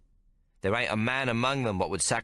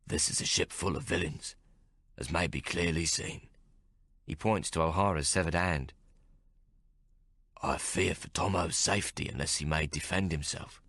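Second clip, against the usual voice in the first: gravelly voice; 2 semitones lower than usual. A narrator's reading. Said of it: "in his gravelly voice".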